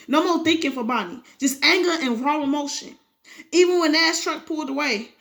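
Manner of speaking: ordinary delivery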